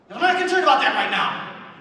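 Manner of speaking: angry yell. Retold or imitated. Coach yelling at the other coach, loud and angry. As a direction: bark it back at him. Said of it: "he yells at me".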